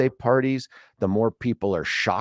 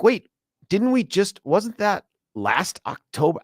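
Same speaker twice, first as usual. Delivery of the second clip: surprised; 8 semitones higher than usual. Retold or imitated. Recalling last year's talk, disbelief.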